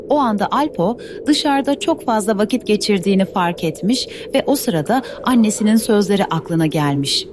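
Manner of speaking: ordinary delivery, nothing marked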